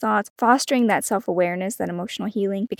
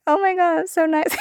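high, gushing delight at own letter; 10 semitones higher, 4 dB louder than usual. Retold or imitated. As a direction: squeal it like a happy surprise. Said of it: "I most always am like".

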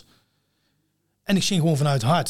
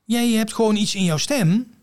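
veel hoger, nadrukkelijke enthousiaste vrouwenstem; lerares nadoen; 8 semitones higher, 4 dB louder, slower than usual. Retold or imitated. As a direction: Irma imiteren die hem hoog en stellig tegenspreekt. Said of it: "Zij zei". (Irma die de zanger geruststelt, veel hoger en stellig)

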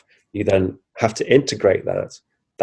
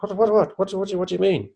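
high, stammering bafflement; retold flustered confusion; 10 semitones higher, 4 dB quieter, faster than usual. Replying to the voice that comes down, flustered. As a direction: repeat it in a high mock fluster. Answering a mysterious voice.

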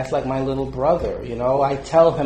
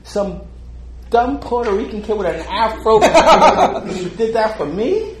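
stunned disbelief; 7 semitones higher, 3 dB louder than usual. Retold or imitated. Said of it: "it was like".

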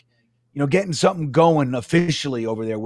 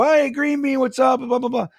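high-pitched imitation of excited fans; fans' greeting; 9 semitones higher than usual. Fans recognizing him on the food line, imitated excited greeting. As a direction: call out a mock fan hello. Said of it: "they would recognize me".

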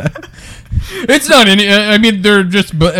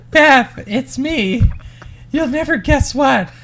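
receptionist voice; 7 semitones higher than usual. Retold or imitated. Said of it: "so she goes".

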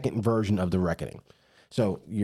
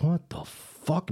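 slow baffled what the fuck; 6 semitones higher, much slower than usual. Retold or imitated. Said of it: "They're like".

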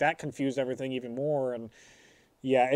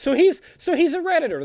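excited; 13 semitones higher, 9 dB louder than usual. Real, not acted. Realisation, laughing.

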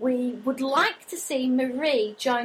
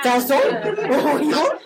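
mock French; 7 dB louder, slower than usual. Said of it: "Is that like a French version of Tarzan?".

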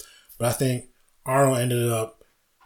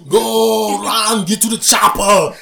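higher, louder, slow Arnold Schwarzenegger yell; Arnold impression; 10 semitones higher, 9 dB louder, much slower than usual. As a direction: bellow the Predator line like Arnold. Arnold chopper line from Predator.

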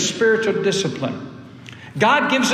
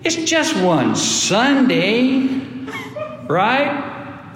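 higher, slow wheedling tempter; tempter's voice; 7 semitones higher, much slower than usual. Retold or imitated. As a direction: coax them lightly, slowing down. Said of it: "there was a voice that spoke to you".

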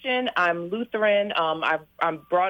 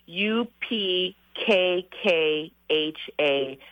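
spelling out slowly; much slower than usual. Shown as a demonstration. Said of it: "that word that I was mentioning".

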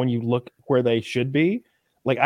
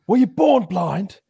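lightly voiced Gordon, a touch higher and slower; quoting Gordon Ramsay; 6 semitones higher, 4 dB louder, slower than usual. Host asking a contestant, mild mock chef voice. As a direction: ask it like a stern TV chef. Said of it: "And Gordon's like".

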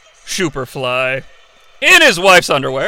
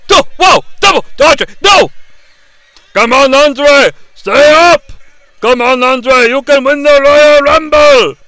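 high, loud fan yelling; root for him at full voice; cheering shout; 6 semitones higher, 5 dB louder than usual. Real, not acted. Urging Andre on from the couch, loud cheer.

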